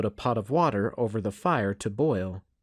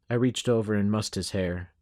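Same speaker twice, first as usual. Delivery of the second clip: usual speaking voice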